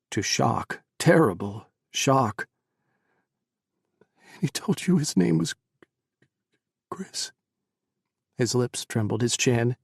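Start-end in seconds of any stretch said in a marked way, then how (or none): 4.4-7.3 higher, rising shocked question fading to a whisper; shocked disbelief. Acted. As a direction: disbelief cracking into a breath. Mother shocked realization.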